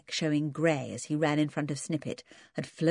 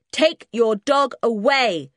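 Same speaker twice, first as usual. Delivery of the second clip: slow, loud, higher shout; slow angry shout; 6 semitones higher, 7 dB louder, slower than usual. Acted. Twins shouting at George about the dog, angry and slow. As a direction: shout it deliberately, furious. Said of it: "shouted the twins".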